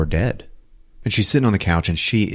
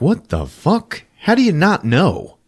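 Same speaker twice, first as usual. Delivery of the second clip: exasperated; 10 semitones higher than usual. Retold or imitated. Wife confronting husband.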